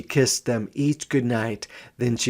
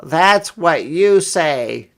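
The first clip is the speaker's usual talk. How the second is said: put-on slow, loud jeering boy voice; 6 semitones higher, 7 dB louder, slower than usual. A narrator's reading. Brother jeers at his sister. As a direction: jeer at her. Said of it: "jeered her brother".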